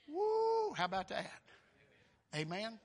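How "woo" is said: high drawn-out "woo"; excited whoop. Real, not acted. Preacher rejoicing at Christ alive forevermore, excited whoop. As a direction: whoop with delight.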